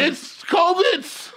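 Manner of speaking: high, slow put-on voice; mock excuse voice. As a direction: drawl the excuse mockingly.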